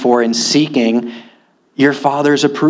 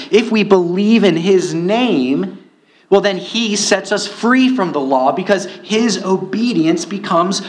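higher, rising preacher's fervor; impassioned preaching; 7 semitones higher than usual. Acted. Preacher urging his congregation toward freedom, fervent and higher. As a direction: build the sermon to its peak. Preaching peak, high pitch.

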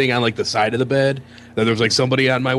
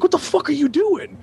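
high-pitched exclaimed protest; retold alarmed outcry; 13 semitones higher than usual. Guests grabbing the woman as she lunges, high alarmed protest. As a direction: act out pulling someone off a fight. Retold crowd stopping a fight.

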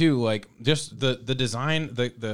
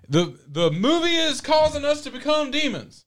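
high-pitched proclamation, held up to the end; hammed-up high announcer voice; mock announcing. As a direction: announce it like an absurd self-appointed leader. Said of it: "just starts announcing things".

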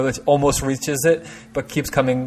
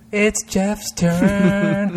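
drawn out; 7 semitones higher, much slower than usual. Real, not acted. Drawn-out handing over turn.

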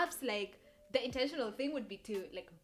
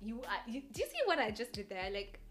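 ordinary talk, nothing marked

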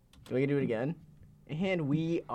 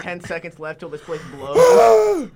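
louder, slow doomsday countdown; mock alarm announcement; 12 dB louder, slower than usual. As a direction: announce the meltdown like a siren warning. Mimics nuclear alarm announcement.

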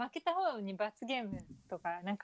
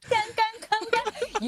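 teasing; 11 semitones higher, 6 dB louder than usual. Acted. Mocking the partner's stumbles.

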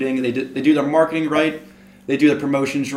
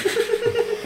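laughing at himself. Real, not acted. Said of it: "I'm getting too passionate".

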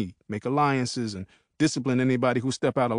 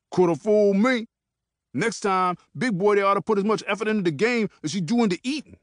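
sneering taunt; 7 semitones higher than usual. Acted. Peanut mocking Lil Don.

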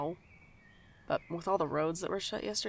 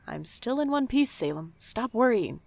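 exasperated reassurance; 5 semitones higher, 6 dB louder than usual. Acted. Brushing off worried friend.

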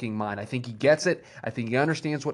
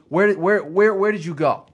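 louder, higher insistent questioning; imitated promo challenge, raised voice; retold wrestling promo; 6 semitones higher, 7 dB louder than usual. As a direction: challenge him, hammering the repeated question, mimicking a promo. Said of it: "the most real promo ever".

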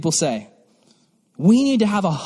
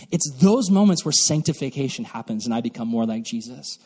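ordinary talk

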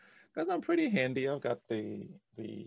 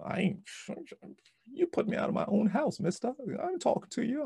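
higher, scolding retold voice; mock-indignant, pitched up; imitated indignant wife; 12 semitones higher, faster than usual. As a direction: play an offended wife telling off her husband. Wife's angry refusal, retold.

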